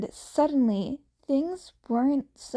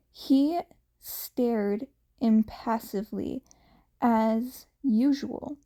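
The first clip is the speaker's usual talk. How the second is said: plain reading voice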